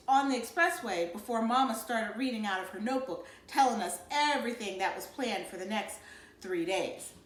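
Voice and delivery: plain reading voice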